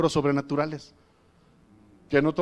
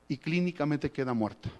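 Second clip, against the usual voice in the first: usual speaking voice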